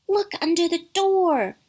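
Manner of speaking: put-on high, loud little kid; urgent cry. A narrator's reading. Kid warns others about the door, crying out. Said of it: "cried another".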